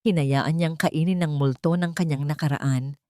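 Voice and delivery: ordinary delivery, nothing marked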